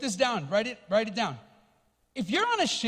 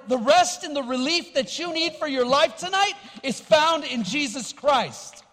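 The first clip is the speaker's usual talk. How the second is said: Jesus pleading; 2 semitones higher, 5 dB louder than usual. Acted. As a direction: beg them to come home, forcefully. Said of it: "He says".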